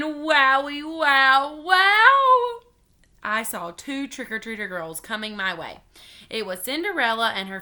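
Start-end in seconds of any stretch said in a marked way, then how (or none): 0.0-2.6 wowed, building higher and louder to a big final wow; amazed outburst. Acted. Young narrator amazed at what is round the corner, building amazement. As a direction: stretch it out in mounting astonishment.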